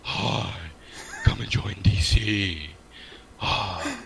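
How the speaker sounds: slow, sultry come-on voice; sexy voice. Acted. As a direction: purr the invitation like Betty Boop.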